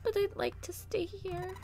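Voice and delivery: soft breathy voice. Acted. June voiced softly, clinging.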